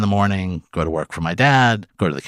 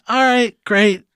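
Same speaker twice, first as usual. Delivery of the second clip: much higher, louder mock enthusiasm; sarcastic cheer; 14 semitones higher, 4 dB louder, slower than usual. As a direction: overplay the sarcasm. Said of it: "I'm like".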